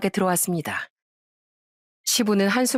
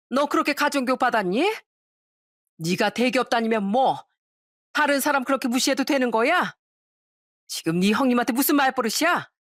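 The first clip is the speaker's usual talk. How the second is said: acted mother-in-law's indignant scolding, mildly raised; scolding yell; 3 semitones higher than usual. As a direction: tell off a rude daughter-in-law without a full shout. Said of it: "거실에서 누군가가 딱 소리를 치더라고요". (시어머니가 호통치듯 꾸짖는 연기)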